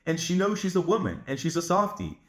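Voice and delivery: normal talking voice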